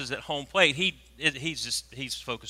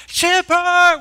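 high, very loud holler; mimicking excited fans screaming; imitated crowd yell; 14 dB louder than usual. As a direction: shout like a whole section on its feet. Imitating the whole section yelling.

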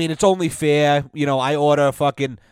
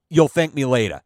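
ordinary talk, nothing marked

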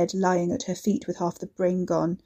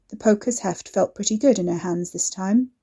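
ordinary delivery

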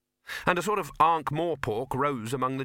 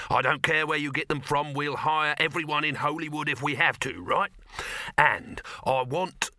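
higher, commanding mogul voice; brash film boss, excited; 4 semitones higher than usual. Acted. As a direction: hand out grand orders like a movie boss.

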